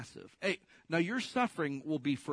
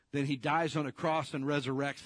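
ordinary talk, nothing marked

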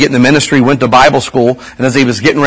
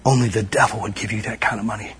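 softer, low hint of a stern wife; a touch of a gentle rebuke; godly wife's rebuke; 4 semitones lower, 12 dB quieter than usual. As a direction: quietly set him straight like his wife. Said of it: "She said".

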